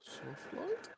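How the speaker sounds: high, soft rising "light"; high question. Real, not acted. Co-host checking a setting, high questioning voice. Asks, then confirms setting.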